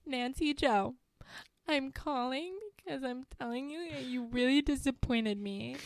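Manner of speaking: high put-on disappointed voice; hammed-up high teen; mock upset teen. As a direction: scold through fake tears, high. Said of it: "She goes".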